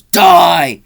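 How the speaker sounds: sudden loud mock-killer shout; shouted movie line. Michael to Loomis before stabbing him, shouted. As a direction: shout it as the killer's one word. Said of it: "and he goes".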